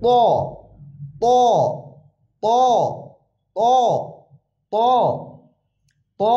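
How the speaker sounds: ত heavy and dark with a rounded mouth; thick, rounded ত. Shown as a demonstration. Teacher showing the heavy letter, thick round ত. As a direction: round the lips for a full, thick ত. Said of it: "মুখটা গোল হবে".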